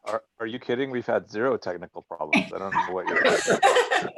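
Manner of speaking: deadpan joke breaking into laughter; mock-serious, then laugh it off; sarcastic. Joking there were zero problems.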